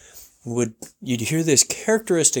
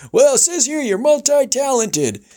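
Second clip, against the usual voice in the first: radio host; 13 semitones higher than usual. Retold or imitated. Host imitated welcoming guest, high showman voice. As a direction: roll it out like a ranch host. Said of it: "He goes".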